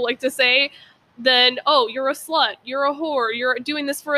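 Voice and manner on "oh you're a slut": normal talking voice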